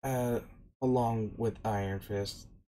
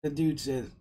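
ordinary delivery, nothing marked

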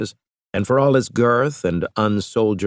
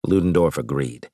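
ordinary delivery, nothing marked

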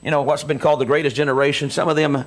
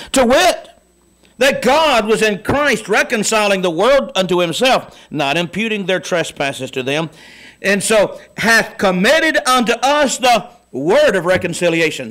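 preached scripture; 5 semitones higher, 3 dB louder than usual. Acted.

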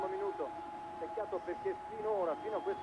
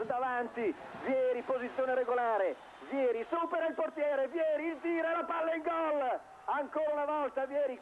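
goal call; 5 semitones higher than usual. Real, not acted. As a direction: build up and burst on the goal. Commentator calls a goal.

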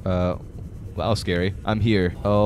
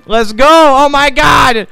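loud, high triumphant shouting; excited celebration; 11 semitones higher, 10 dB louder than usual. Real, not acted. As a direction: build to a jubilant yell. Celebrating progress in the game.

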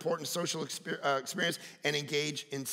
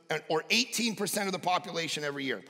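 normal talking voice

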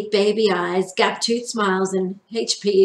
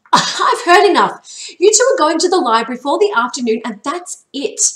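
much higher, louder, fast final word; exasperated mother; 7 semitones higher, 7 dB louder than usual. A narrator's reading. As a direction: cut the boys off sternly. Mother ends the argument.

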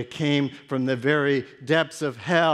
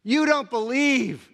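accusing; 10 semitones higher, 5 dB louder than usual. Acted. Disciple accuses the father.

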